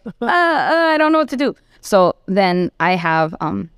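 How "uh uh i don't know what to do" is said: high, flustered stammer; a mock-panicked fluster, pitched up; imitating her own flustered panic. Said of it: "I was like".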